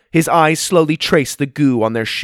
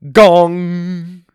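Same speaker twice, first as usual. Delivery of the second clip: loud, ringing gong with held hum; imitated gong; 6 semitones higher, 9 dB louder than usual. A narrator's reading. Clock tower striking in the street, loud imitated gong. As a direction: boom out a clock chime. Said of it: "they heard a gonging noise".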